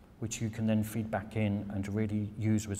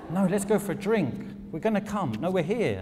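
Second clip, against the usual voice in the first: much higher and louder, pushy and gushing; insistent American; 9 semitones higher, 5 dB louder than usual. Retold or imitated. Americans insisting on drinks with the visiting Brit, high and pushy. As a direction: steamroll her into a drink. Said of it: "And they're like".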